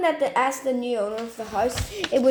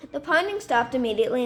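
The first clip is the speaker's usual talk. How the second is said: ordinary delivery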